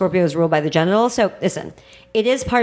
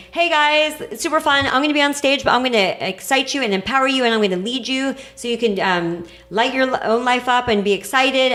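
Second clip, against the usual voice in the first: higher, bright cheerleader energy; upbeat excitement; 5 semitones higher than usual. Acted. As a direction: pump up the crowd with joy. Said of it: "the higher expression of Leo is saying".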